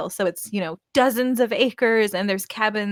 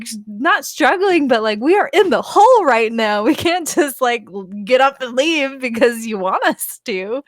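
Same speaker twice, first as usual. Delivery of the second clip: high, loud exasperated objection; retold incredulous protest; 5 semitones higher, 5 dB louder than usual. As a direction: act out protesting to your brother in disbelief. Retelling argument with brother.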